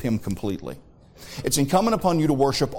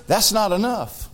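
high, forceful pulpit delivery; high-pitched sermon emphasis; emphatic preaching; 7 semitones higher than usual. Real, not acted. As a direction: drive each point home, pausing before the payoff.

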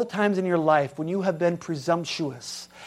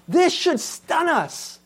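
slow, higher, held in wonder; stunned; 9 semitones higher, slower than usual. Real, not acted. Preacher urging the congregation to be stunned. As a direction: let the shock sit on every word.

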